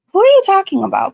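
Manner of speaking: a sudden loud, fast, high confused question. Real, not acted. Streamer asks a chatter who she means, loud and baffled. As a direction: blurt it out, baffled. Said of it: "I'm confused".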